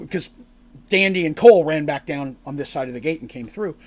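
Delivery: ordinary talk, nothing marked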